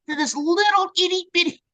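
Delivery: high-pitched, playful itty-bitty voice; teeny-tiny voice. Retold or imitated. Singer mocks artwork shrunk onto a cassette, high tiny voice. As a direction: pitch it way up to sound miniature.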